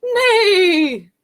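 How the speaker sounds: high, loud whinny sliding down; whinny like a horse; horse neigh. Pretend. Showing children what horses say, high loud neigh. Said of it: "They say".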